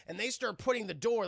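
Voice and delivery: ordinary talk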